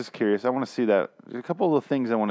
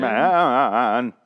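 louder stretched "man"; drawn-out "man"; 3 semitones higher, 7 dB louder than usual. Retold or imitated. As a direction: drag the word "man" out. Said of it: "the word man ... seven and a half syllables".

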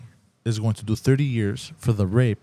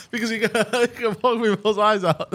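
laughing through the words; giggling out the line; 8 semitones higher than usual. Real, not acted. Laughing while repeating joke.

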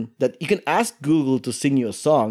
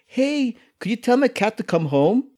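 talking to cats; 6 semitones higher than usual. Retold or imitated. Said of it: "head over to the local stray cats and go".

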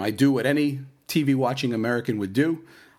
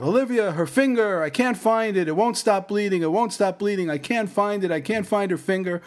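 high, frantic pleading; imitating his panicked wife on the phone; 9 semitones higher than usual. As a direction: voice her panic, pitched way up.

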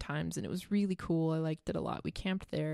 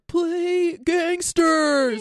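crowd heckle yell; 10 semitones higher, 9 dB louder, much slower than usual. Retold or imitated. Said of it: "they're probably like".

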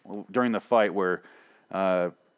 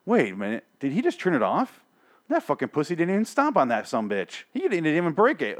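imagined scoffing Michonne; 7 semitones higher than usual. Pretend. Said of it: "She's not gonna be like".

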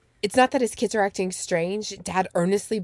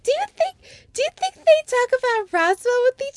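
very high excited fan squeal; giddy fan; 3 dB louder than usual. Retold or imitated. Fan imagining the actors chatting, giddy and high. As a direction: gush it like a delighted fan, much higher. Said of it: "I'm like".

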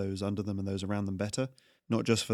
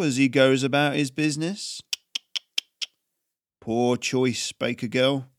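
louder, bullying official, building to a threat; menacing tax collector; 3 semitones higher, 5 dB louder than usual. Acted. Tax collector threatening the baker girl, menacing and louder. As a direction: lean on her like a petty bully.

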